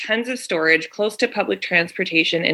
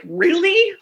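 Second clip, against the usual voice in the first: exasperated really; 10 semitones higher than usual. Retold or imitated. Exasperated retold reaction.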